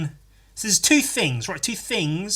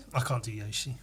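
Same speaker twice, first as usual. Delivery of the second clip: ordinary talk, nothing marked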